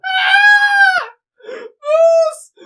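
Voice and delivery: high-pitched full-volume shriek; piercing panicked cry; startled scream. Acted. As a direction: scream it in alarm at the sight. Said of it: "you hear someone go".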